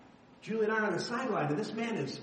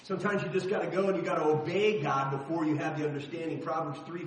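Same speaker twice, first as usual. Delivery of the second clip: ordinary talk, nothing marked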